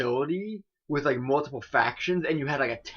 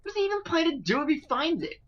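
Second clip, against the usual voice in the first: lightly voiced exasperated girl; 11 semitones higher than usual.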